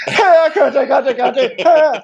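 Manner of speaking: very high, loud screeched cat call; deaf-cat call. Retold or imitated. Chef's deaf-cat punchline, screeched cat call. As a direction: comically screech for a cat that can't hear. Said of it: "how do you call a deaf cat".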